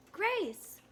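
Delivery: high excited cheer; shouting. A narrator's reading. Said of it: "shouted Ralph".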